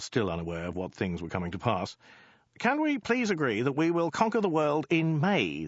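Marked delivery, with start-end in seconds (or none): 2.6-5.7 high, strained-patience appeal; high-pitched, insistent pleading; exasperated plea. Acted. Ogdai pleads at the end of patience.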